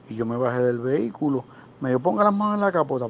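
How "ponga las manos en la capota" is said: imitating a cop, much higher at the start; mock police order, high and sharp; police officer orders the guard out of the car, higher; cop's commands.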